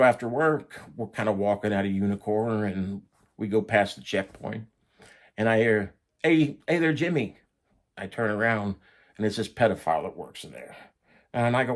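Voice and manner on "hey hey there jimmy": higher, louder hailing voice; imitated calling out. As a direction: act out a call to get someone's attention. Pedophile calls him from behind.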